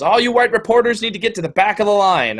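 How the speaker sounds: mock protester yell, pitched high; imitated yelling. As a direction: imitate someone ordering a crowd back. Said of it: "she's yelling at the reporters".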